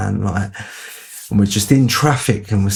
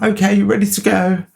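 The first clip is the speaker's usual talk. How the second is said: much higher, breezy; Adam hides the stolen steaks, innocent; mock innocence; 11 semitones higher than usual. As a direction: play it sweetly innocent. Adam acting innocent after theft.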